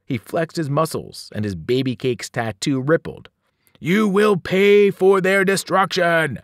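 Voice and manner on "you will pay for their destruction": slow, loud, high cannibal giant voice; wailed threat. A narrator's reading. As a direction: bellow a drawn-out vow of revenge. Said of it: "Joe Bob the Cannibal wailed".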